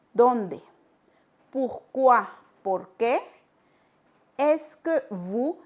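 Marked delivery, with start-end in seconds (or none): 4.4-5.6 Est-ce que vous drawn out slowly, rising; slow demo. Shown as a demonstration. Teacher slowly modelling the question structure. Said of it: "esta estructura".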